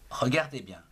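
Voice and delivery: usual speaking voice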